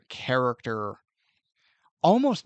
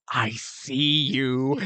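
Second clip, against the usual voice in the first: Nazgul voice; 4 semitones lower, slower than usual. Retold or imitated. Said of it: "the Nazgul just looks at him and goes".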